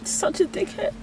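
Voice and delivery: real crying, voice gone to breath; tearful, high breath of self-reproach between sobs. Real, not acted. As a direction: choke it out through tears. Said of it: "I can't believe I cried again".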